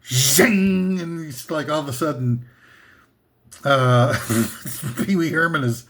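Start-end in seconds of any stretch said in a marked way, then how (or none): 0.0-1.0 a punchy, ringing "Zhang!" like a show returning from commercial; imitated TV curtain sound effect. Imitating the sound effect a show plays coming back from commercial. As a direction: belt out the comeback-from-the-break sting. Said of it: "sound effect when they come back from the commercial".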